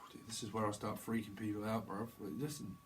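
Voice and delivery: hushed whisper. Real, not acted. Psychic whispers to viewers about freaking them out. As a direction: lean in and whisper it.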